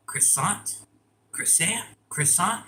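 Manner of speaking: croissant as a tentative question, kri-SAHNT; unsure pronunciation. Real, not acted. Streamer checking she says it right, unsure croissant. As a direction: try out "croissant", each one lifting into a question. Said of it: "Am I saying it right?".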